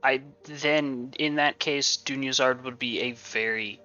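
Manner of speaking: ordinary talk, nothing marked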